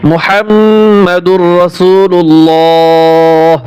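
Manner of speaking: মুহাম্মাদুর রাসূলুল্লাহ stretched slowly with doubled m; slow recitation. Shown as a demonstration. Teacher modelling the kalima for learners. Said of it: "আল্লাহ লামটা মোটা হবে".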